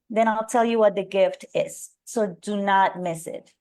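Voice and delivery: ordinary talk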